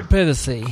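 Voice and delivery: echoing "Privacy" the British way, short first vowel; mock-British "Privacy"; British pronunciation. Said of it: "as they say over there".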